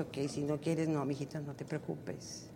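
soft, gentle comfort to her son; tender reassurance. Retold or imitated. Mother hugging her son, softly reassuring him.

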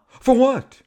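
high, loud mock-baffled retort; indignant. Shatner answers my congratulations, indignant. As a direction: imitate a sharp, incredulous comeback.

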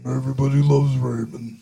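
low take on the sitcom brother saying the title; lightly voiced sitcom brother; big brother voice. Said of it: "Yeah, there you go".

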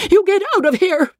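very high, frantic woman's outburst; frenzy. Acted. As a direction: order them out in a fast, high frenzy. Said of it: "She turned in a frenzy".

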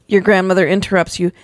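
ordinary delivery, nothing marked

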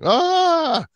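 high, loud mock scream. Co-host voicing his contorted animated self, screaming in mock agony. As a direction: pretend to scream with a twisted arm. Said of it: "and I'm screaming".

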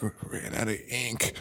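soft, slow croak of a dying man; hushed dying whisper; mock dying man. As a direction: barely get the words out, fading. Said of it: "Why is he talking like that?".

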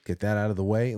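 usual speaking voice

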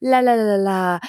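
lightly voiced airy sing-song spiritual type; mock serene priestess. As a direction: tease the blissed-out self, la la la. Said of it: "I'm kind of making fun of them".

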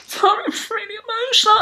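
high, breathy fake-emotional gasp; mock teary contestant. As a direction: play a queen overcome with tears. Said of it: "Someone would be like".

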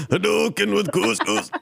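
high, punchy Street Fighter special-move call; fighting game shout. Pretend.

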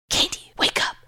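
urgent hushed whisper; frantic whisper. A narrator's reading. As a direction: whisper it, scared and rushed. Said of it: "my sister's frantic whisper at my door".

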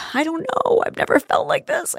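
high, half-whispered, close to tears; tearful reply. Retold or imitated. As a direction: say it as if crying too hard to voice it. Crying uncontrollably by his bed.

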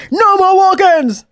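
high, drawn-out call; stretched, high-pitched mock announcement; walkie-talkie doorman. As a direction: imitate a doorman calling out over a walkie-talkie. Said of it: "the guy was on the walkie-talkie".

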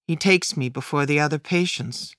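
usual speaking voice

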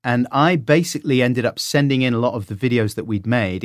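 ordinary talk

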